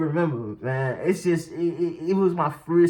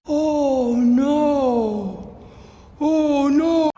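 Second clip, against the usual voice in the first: high, loud, long-held wail; mock wail; 7 semitones higher, 5 dB louder, much slower than usual. Storyteller replaying feeling the bump, hammed-up dismayed wail.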